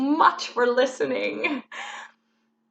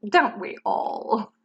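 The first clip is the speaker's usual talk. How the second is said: ordinary talk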